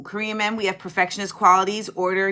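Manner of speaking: ordinary talk, nothing marked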